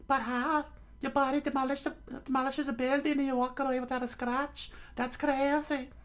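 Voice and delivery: squeaky falsetto, rattled off in disbelief; shocked Nami. Acted. Crewmate to Luffy after the crash, shrill disbelief. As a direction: play a very high-pitched girl in shock.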